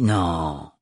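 low guttural interruption; cutting in. A narrator's reading. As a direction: cut her off low. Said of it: "la coupa la mère Hildegarde".

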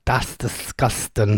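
slow, low Norfolk "That's disgusting"; Norfolk accent. Shown as a demonstration. Host correcting a caller's Midlands attempt, slow Norfolk. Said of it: "say it again".

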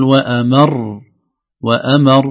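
وأمر with a strong push on "mar" and a lengthened r; stressed doubled ra. Shown as a demonstration. Teacher answering the host, held doubled r in وأمر. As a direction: hit the end of وأمر and hold the r. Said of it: "زيادة في زمن الراء المشددة".

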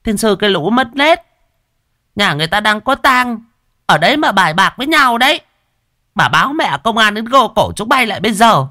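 put-on high, loud scolding old crone voice; scolding old woman. A narrator's reading. Old woman scolding the gamblers at the funeral, shrill and loud. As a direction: berate them like a cranky village granny. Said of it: "Một bà nhổ toẹt miếng nước dầu".

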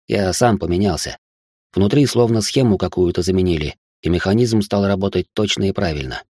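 ordinary delivery, nothing marked

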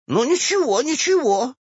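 put-on high-pitched huffy old woman; offended. A narrator's reading. Old woman answers the clerk, offended. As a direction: bristle at the slight in a high voice. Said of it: "обиделась старуха".

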